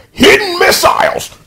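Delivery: high, punchy "Hidden missiles!"; excited callout. Retold or imitated. Fan quoting Doctor Doom to listeners, high excited callout. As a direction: shout it like a game move.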